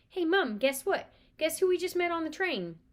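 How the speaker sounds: loud, high eager blurt; excited news. Acted. Twins telling mother gossip, loud eager excitement. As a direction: burst out with the big news.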